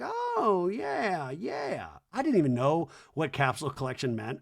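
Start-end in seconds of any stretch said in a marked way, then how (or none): 0.0-1.9 [name] voice. Retold or imitated. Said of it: "She's like".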